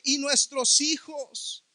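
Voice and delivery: sermon climax, slow and loud; rising preaching. Acted. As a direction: let grief swell into a shout.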